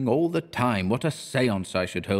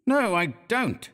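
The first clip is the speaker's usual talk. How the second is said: mildly angry, raised and slow; painter's angry retort; 11 semitones higher than usual. A narrator's reading. Painter answers the teasing model, a mild angry retort. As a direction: snap back at the model, slowed and pitched up. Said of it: "I said angrily".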